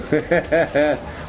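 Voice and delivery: quick rolling chuckle, pitched high; real laugh. Real, not acted. Owner owns up to adopting the dog, real laugh.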